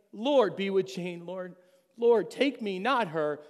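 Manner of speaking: loud, slow pleading cry to the Lord; screaming prayer. Retold or imitated. Man soaked in the rain pleads with God, loud cry. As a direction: shout your prayer into the pouring rain. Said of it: "And I'm screaming out to God".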